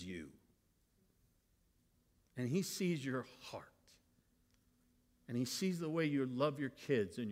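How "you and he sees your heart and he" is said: soft whisper. Real, not acted. Tender reassurance to parents.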